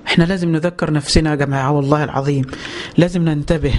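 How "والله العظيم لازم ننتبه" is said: ordinary talk, nothing marked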